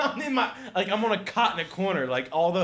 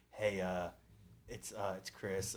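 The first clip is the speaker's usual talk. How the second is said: sheepish voicemail; 11 semitones lower, 16 dB quieter, slower than usual. Retold or imitated. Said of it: "he was like".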